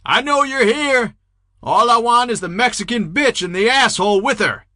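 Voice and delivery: loud, high, thinner threatening yell. Acted. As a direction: scream threats into the dark, voice straining. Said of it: "Another voice yelled out".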